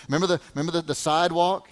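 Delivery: usual speaking voice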